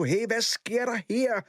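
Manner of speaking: Kamma's mother breaking up the squabble; mother's voice. A narrator's reading. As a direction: break up the kids as mum. Mother bursts in on fight.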